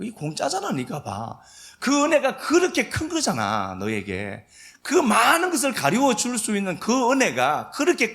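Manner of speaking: 공짜잖아 and 그렇게 큰 거잖아 called out high and loud; booming rebuke. Acted. Preacher voicing God scolding Jonah and Israel, loud and high.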